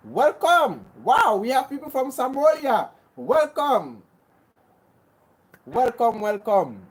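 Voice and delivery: ordinary talk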